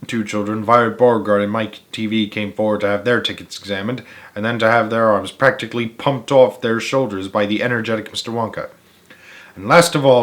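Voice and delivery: usual speaking voice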